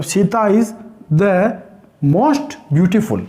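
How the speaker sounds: slow, drawn-out superlative; teacher slowly dictating the superlative example; slow dictation. Shown as a demonstration. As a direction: space out the words for dictation. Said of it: "मुझे ये कहना पड़ेगा".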